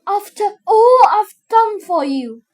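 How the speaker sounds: high, loud reproach; loud, high, aggrieved outburst; outraged cry. A narrator's reading. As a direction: cry out in wounded disbelief. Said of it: "cried Antonio".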